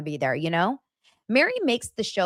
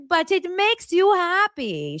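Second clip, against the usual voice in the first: high, slow coaxing chef voice; mock chef; 11 semitones higher, slower than usual. Imitating the chef insisting, slow high coax. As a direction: coax her slowly. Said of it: "He's like".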